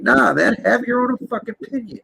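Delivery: ordinary talk, nothing marked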